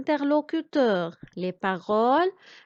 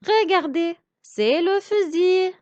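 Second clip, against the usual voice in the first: normal talking voice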